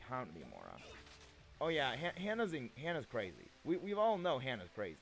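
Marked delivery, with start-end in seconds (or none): none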